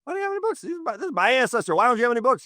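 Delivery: high, rising disbelief at the ranger; a retold outraged "why not?"; incredulous protest. Author to park ranger, mock indignant and high. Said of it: "I was like".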